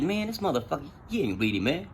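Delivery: retold private doubt, voice dropped in volume; suspicious aside. Inmate doubts his friend is reading, quiet suspicious aside.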